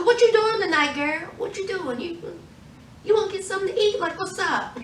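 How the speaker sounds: imitated smooth-talking flirt; mock flirty come-on. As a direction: play a smooth guy chatting her up. Assassin flirting, asking Eve out.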